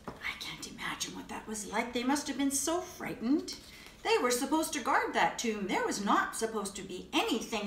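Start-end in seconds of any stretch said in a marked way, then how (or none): 0.1-1.9 soft, breathless whisper of wonder; awed whisper. Acted. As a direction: whisper it as if picturing the scene. Awed aside in children's story.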